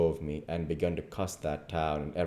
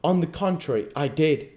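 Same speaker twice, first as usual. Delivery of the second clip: higher, pointed contradiction; sarcastic drawl; 10 semitones higher, 6 dB louder than usual. A narrator's reading. Duke mimicking the king's manner, sarcastic drawl. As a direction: contradict him with measured irony. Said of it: "The Duke says the same way".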